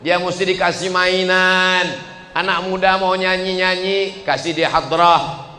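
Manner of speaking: usual speaking voice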